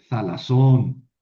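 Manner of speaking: thalasón slow, with a loud, drawn-out -SÓN; stress on last syllable. Shown as a demonstration. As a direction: throw the accent onto the end of thalasón. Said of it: "aquí también tuve que mover el acento". (thalasón lento, con acento fuerte en la última sílaba)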